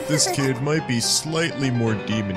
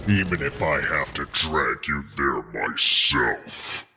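low, slow, sinking menace; menacing threat; 8 semitones lower, slower than usual. Acted. Captor vowing to drag kid to hell, menacing. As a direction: drop low and draw out the threat.

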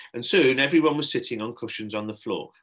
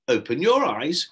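instructing; 5 semitones higher, 7 dB louder than usual. A narrator's reading. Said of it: "Shelley instructed".

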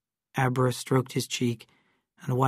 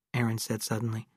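usual speaking voice

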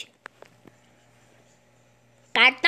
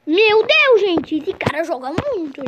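ordinary delivery

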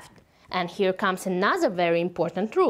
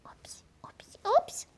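whispered oops; 5 dB quieter than usual. Acted.

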